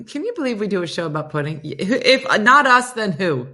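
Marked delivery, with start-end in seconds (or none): 1.6-3.5 mock grand. Mock grand rhetorical question.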